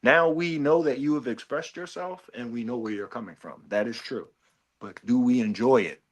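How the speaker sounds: ordinary talk, nothing marked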